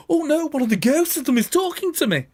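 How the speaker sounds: high alarmed mother goat; hammed-up ghost scare; mock fright. Mother goat hearing a voice from the tree, high mock fright.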